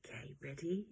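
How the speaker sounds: put-on hushed whisper to the children; unvoiced whisper, building anticipation. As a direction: breathe it softly, full of suspense. Whispering to children before sound.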